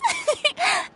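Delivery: short high giggle of joy; happy little laugh; delighted laugh. Acted. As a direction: giggle with glee. Delighted at a surprise gift.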